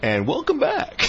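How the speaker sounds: mock anchor voice. Anchors snap into on-air voice.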